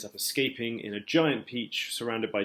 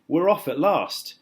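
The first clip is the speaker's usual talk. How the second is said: excited; 5 semitones higher, 5 dB louder than usual. A narrator's reading. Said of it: "someone was shouting".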